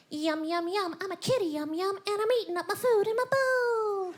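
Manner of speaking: cat voice. Retold or imitated. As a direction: coo it like a pampered cat eating. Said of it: "started doing his voice".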